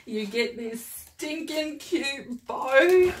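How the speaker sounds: high, drawn-out gush of delight. Real, not acted. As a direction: coo slowly and high over something adorable. Gushing over a cute bow.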